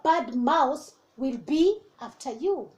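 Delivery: ordinary delivery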